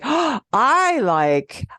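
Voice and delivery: "I" punched high and drawn out after a gasped "Oh"; gushing emphasis on "I"; super emphatic. Shown as a demonstration. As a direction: hit "I" hard and stretch it very slowly. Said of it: "punch with our voice".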